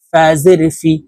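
ordinary talk

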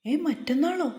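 high-pitched, surprised question; startled. A narrator's reading. Wife learns they start at the college so soon, startled. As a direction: jump up in pitch, taken aback. Startled by sudden news of joining.

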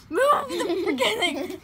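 slow, laughing through annoyance; laughing frustration. Real, not acted. Frustrated while fumbling with toys.

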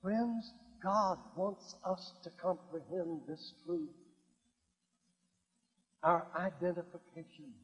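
low, soft, reverent confiding tone; hushed preaching. Acted. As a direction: drop low and soft to share a deep conviction.

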